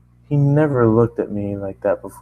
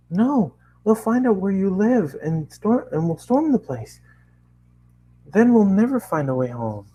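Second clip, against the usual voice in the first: fearful Twilight; 9 semitones higher than usual. Acted. Twilight frightened.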